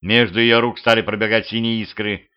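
ordinary delivery

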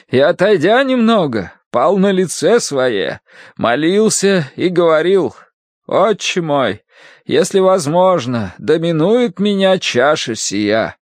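high, slow scripture reading; stern Bible-reading woman. Acted. Woman reading Matthew to a patient, high solemn intoning. Mrs. Gadson reads Matthew.

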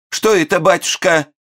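high, frightened falsetto gasp; startled falsetto. A narrator's reading. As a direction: squeak it out in fright. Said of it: "испугалась вдовица".

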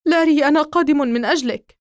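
Donny shouting to Larry as a stranger grips his arm; calling out urgently. Acted. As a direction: yell it as you run to help him. Friend rushing to rescue Larry.

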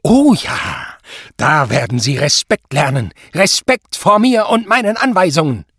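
high, loud, relishing threat; menacing anger. A narrator's reading. Superior demands respect from Zott, loudly menacing. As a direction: hammer home the demand for respect.

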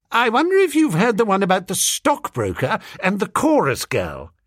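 a hint of a nervous swell grasping at a joke; desperate young gent. Young gentleman offering schoolgirls a story, lightly voiced and flustered.

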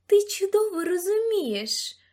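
high, loud, slow accusation; angry accusation. A narrator's reading. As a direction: press them hard, drawing it out. Charlotte confronts the narrator over chest.

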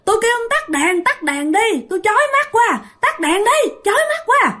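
high shrill screaming demand; possessed girl screaming. Retold or imitated. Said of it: "mà nó cứ la làng". (cô gái bị nhập gào thét giọng the thé)

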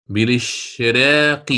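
بالاشراق slowly, the qaf lengthened with its kasra; slow recitation demo. Shown as a demonstration. Teacher demonstrates بالاشراق slowly for the class. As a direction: draw out بالاشراق to show the qaf's kasra. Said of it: "نظر إلى كسرة القاف".

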